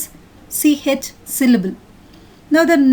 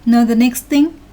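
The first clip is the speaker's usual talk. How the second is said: usual speaking voice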